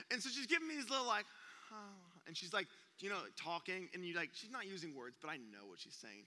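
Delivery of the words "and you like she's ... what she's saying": ordinary talk, nothing marked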